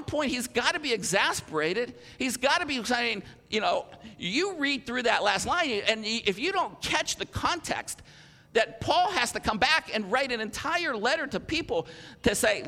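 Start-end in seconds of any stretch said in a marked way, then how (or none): none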